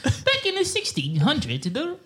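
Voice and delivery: high, put-on tour guide voice; hammed-up old-time tour guide; tourist guide voice. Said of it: "tourist guide voice".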